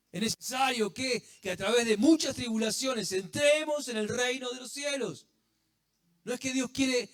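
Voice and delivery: fervent preaching. Real, not acted. Preacher building to emphatic point.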